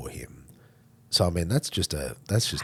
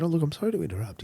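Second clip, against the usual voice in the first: higher-pitched, polite interruption; apologetic stranger; 11 semitones higher, faster than usual. Retold or imitated.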